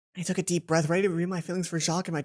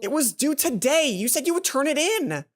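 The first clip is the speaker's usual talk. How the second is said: angry; 6 semitones higher, 4 dB louder than usual. Acted. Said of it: "She looked downright furious".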